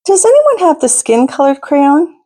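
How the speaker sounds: friend's question. Acted. Friend voiced in read-aloud.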